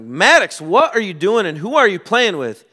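loud, high demand from dad; stern parental yell. Retold or imitated. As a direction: call out to your son, demanding answers. Father confronting his screaming son.

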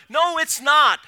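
ordinary talk

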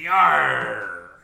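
loud, drawn-out pirate "yar"; pirate yar with stank. Shown as a demonstration. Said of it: "Put a bit more, more stank on it".

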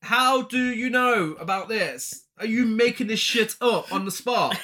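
loud, high-pitched incredulous accusation; exasperated demand. Acted. Co-host accuses Brian of making it up, exasperated demand. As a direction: shout it like you've caught him lying. Confronting the character over plot hole.

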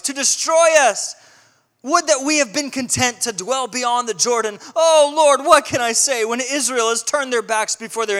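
loud, high, desperate cry to God; panicked lament. Acted. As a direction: wail it out as a man in panic. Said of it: "Joshua is clearly in panic".

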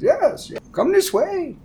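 very high-pitched creature priest; Kuatoa archpriest. Acted. Archpriest invites the visitors along, high-pitched welcome. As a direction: usher them along in a tiny high voice. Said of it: "In front of you is the, uh, archpriest".

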